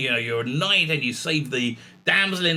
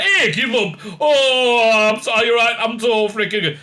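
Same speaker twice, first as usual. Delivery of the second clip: mock smug, higher and louder; smug Doctor; 6 semitones higher, 5 dB louder than usual. The Doctor lapping up the companions' praise, mock smug. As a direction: lap up the praise in a hammed-up voice. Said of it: "she's like".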